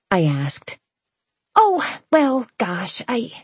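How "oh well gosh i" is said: stall, caught out and embarrassed; flustered. A narrator's reading. Cornelia put on the spot, stammering.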